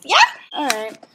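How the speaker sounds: usual speaking voice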